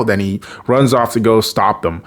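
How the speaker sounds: ordinary talk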